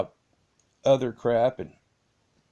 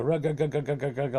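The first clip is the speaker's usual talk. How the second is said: higher, rapid rug-rug of a car failing to start; imitated engine cranking; 4 semitones higher than usual. Driver cranking the stalled car in panic, engine-crank imitation. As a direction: mimic the starter grinding over and over. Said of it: "like that".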